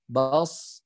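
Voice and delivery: "bas" ending in a thick emphatic s; sukun tebal. Shown as a demonstration. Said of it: "dia jadi tebal". ("bas" dengan akhir tebal, pangkal lidah naik)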